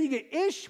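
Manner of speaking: ish said short and clipped, pitch up. Shown as a demonstration. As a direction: snap off ish in one beat. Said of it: "It's only one syllable".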